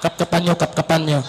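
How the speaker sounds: very low radio-alert voice; low, repeated security alert; guard radioing in. Acted. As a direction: drop deep like a guard on a walkie-talkie. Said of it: "may nagraradyo na".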